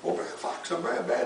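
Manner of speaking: plain reading voice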